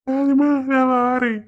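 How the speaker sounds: husband mocks his wife's parting line; mocking her words. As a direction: mimic her line slowly to tease her. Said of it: "ஆளை பாரு".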